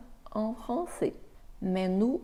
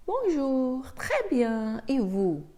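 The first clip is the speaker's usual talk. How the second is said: second character; 5 semitones higher, slower than usual. Acted. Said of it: "faire la différence entre les deux personnages".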